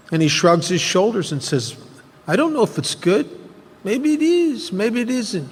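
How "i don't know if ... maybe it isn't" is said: put-on high-pitched Yiddish-accented old man; old farmer with a touch of Yiddish accent. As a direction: shrug it off in a lilting Yiddish accent, high and light. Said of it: "He has a little bit of a Yiddish accent".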